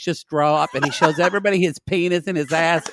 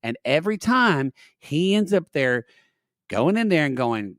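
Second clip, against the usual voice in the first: usual speaking voice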